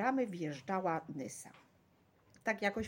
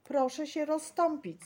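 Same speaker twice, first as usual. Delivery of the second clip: raised, high command; sharp-voiced call over a crowd; 7 semitones higher, 5 dB louder than usual. A narrator's reading. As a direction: order the crowd to make way. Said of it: "zawołał jakiś ostry głos".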